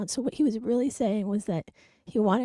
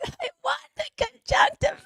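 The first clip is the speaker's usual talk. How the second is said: put-on high, louder child wail; mock yell like a tantrumming kid; imitating her son's tantrum; screaming toddler; 4 dB louder than usual. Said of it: "he just started screaming".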